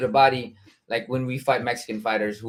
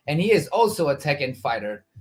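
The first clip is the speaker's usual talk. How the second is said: ordinary talk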